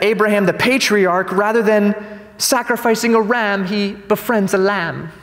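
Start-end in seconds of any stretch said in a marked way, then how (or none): 2.4-3.7 light mock British accent. Reading the article to viewers in a British accent. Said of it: "I just spoke in a British accent there". 3.7-5.1 put-on slow British accent on "he befriends a lamb"; "he befriends a lamb" said slowly in a mock British accent. Reading PETA's version to viewers in a British accent. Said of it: "I just spoke in a British accent there".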